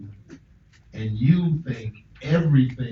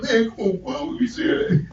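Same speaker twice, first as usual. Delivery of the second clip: much higher, incredulous mock pleading; retold protest pitched way up; acted incredulous appeal; 11 semitones higher than usual. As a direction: act out a high-voiced "come on, man". Acted banter with suicidal inmates.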